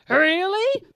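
lion taunting reflection; mocking lion. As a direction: scoff it. Lion sneers.

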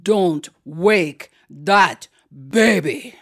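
stern, very slow, word-by-word warning; deep-voiced aunt. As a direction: warn a child, one weighty word at a time. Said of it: "she had a deep, deep voice".

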